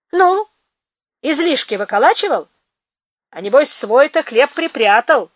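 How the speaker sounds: loud, accusing jab. Acted. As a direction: press him hard. Interrogating the peasant.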